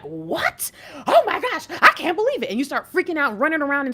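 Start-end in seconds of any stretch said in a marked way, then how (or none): 0.0-2.5 sudden squeal of amazement, loud and high; shocked disbelief. Retold or imitated. You hear God's message and squeal in disbelief. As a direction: act stunned, voice jumping up. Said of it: "and you're like".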